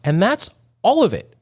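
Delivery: high, sustained preacher cadence; mock preaching. Host sums up on practice for listeners, mock preaching. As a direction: preach the closing lesson high on held pitches.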